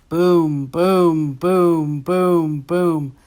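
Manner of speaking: drawn-out, resonant imitated booms; booming. A narrator's reading. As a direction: sound out giant footfalls, each BOOM stretched and falling. Story's ominous booms before earth trembles.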